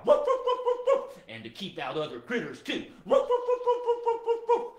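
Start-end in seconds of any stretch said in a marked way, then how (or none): 0.0-1.2 high, loud rapid woofs; dog barking. Pretend. Guard dog barking at visitors, loud high woofs. 3.0-4.7 high, loud rapid woofs; dog barking. Pretend. Guard dog barking at visitors, loud high woofs.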